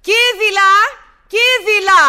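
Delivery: loud call for the maid. Acted. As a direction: shout the maid's name across the house. Mistress calling the maid.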